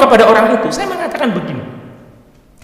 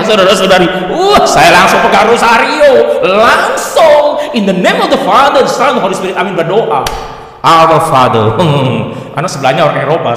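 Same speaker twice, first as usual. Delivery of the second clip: usual speaking voice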